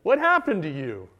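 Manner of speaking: loud accusing question; mock accusation. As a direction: imitate a friend demanding an explanation. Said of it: "And accusation came".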